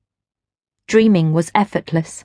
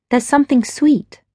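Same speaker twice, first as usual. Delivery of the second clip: higher, curious; surprised young wife; 6 semitones higher than usual. A narrator's reading. Gwen asks the stranger, a touch surprised. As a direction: ask it, caught out. Gwen's voice.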